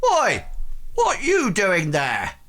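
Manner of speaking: loud, high-pitched challenge from a burly worker; rotund worker. Acted. Rotund blacksmith finding strangers at his door, loud challenge. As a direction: call out sharply, high and loud. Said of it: "a rather rotund man".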